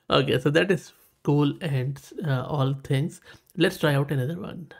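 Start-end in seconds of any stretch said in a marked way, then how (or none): none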